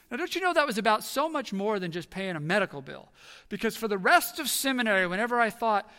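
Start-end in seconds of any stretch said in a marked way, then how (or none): none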